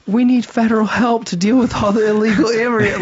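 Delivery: mock a helpless official; mocking the mayor. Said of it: "the mayor of D C is like".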